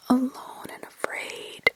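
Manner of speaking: ordinary delivery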